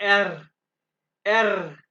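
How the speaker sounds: एर said with a strongly trilled, vibrating tongue R; rolled R. Shown as a demonstration. Teacher showing the class a trilled R in एर. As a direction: roll the R in एर hard, letting the tongue vibrate. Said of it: "यहाँ पे आपने जुबान को vibrate करना है".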